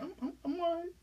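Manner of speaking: high, soft tearful stammer; mock crying. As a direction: imitate choking back tears. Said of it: "I called him crying".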